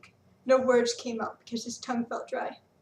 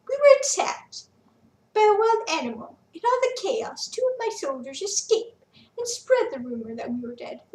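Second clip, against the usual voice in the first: high, loud, theatrical villain; 7 semitones higher, 6 dB louder than usual. Acted. Quintus boasting to Felix how he survived, theatrical.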